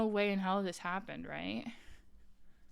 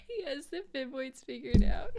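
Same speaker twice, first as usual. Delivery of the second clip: punchline repeated while laughing; 3 semitones higher than usual. Real, not acted. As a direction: squeeze it out between laughs.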